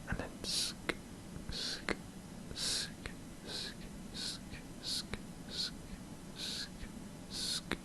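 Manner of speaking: soft sk sk trigger sounds; repeated sk mouth sounds. Shown as a demonstration. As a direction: click out sk over and over. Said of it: "I like that sound".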